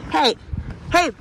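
shouting hey at a bear; scaring off a bear. Real, not acted. Yelling to scare off bear.

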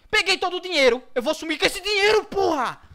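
high loud triumphant cheer; excited. Real, not acted. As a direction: crow over the stolen money. Grabbing the loot excitedly.

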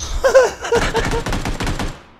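shrieking delighted laugh; high excited laugh. Real, not acted. After the fight is won.

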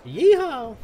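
loud, high yeehaw; cowboy whoop. Pretend. Cowboy off to get drunk at the bar, whooping. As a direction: holler it like an excited cowboy.

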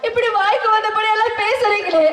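ordinary delivery

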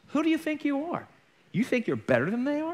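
high-pitched retold scolding; indignant rebuke. Woman puts preacher in his place, high indignant rebuke.

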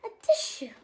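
high, loud sneezy voice; salt pot sneezes for a tissue; sneezing. A narrator's reading. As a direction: sneeze the words out. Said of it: "sneezed Rolly".

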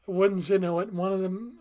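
ordinary talk

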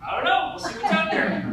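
high, eager dog voice; playful excited pet, laugh creeping in. Acted. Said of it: "he gets all excited".

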